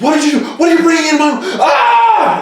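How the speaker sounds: very high, full-volume panicked shriek; hammed-up hysterical mom screaming. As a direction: screech it like a mother in hysterics, ending in a scream. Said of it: "mom's panicking and starting to hyperventilate".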